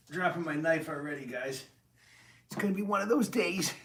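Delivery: mock exasperated. Real, not acted. Self-mocking complaint.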